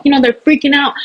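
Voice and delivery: normal talking voice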